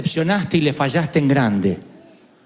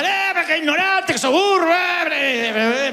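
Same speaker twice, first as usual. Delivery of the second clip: a father shouting at his son who failed school; imitating dad yelling; 11 semitones higher, slower than usual. Dad berating his son over failing school, mock yelling.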